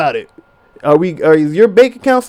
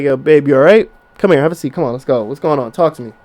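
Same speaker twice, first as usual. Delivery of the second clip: calm reassuring; 6 semitones lower than usual. Retold or imitated. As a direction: settle her down, low and easy. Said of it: "You just wanna be like".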